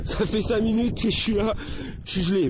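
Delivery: high laughing through the cold. Real, not acted. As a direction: laugh it off while freezing. Laughs at freezing after five minutes.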